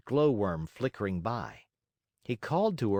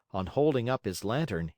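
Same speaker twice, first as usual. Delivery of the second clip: usual speaking voice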